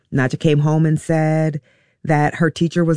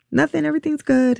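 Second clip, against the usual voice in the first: much higher and fast, a bright cover-up over tears; forced cheerful; 12 semitones higher, faster than usual. Retold or imitated. Mother to worried daughter on the phone, faking cheerful. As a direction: brush it off quickly, pretending all is fine. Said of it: "trying to make my voice sound normal".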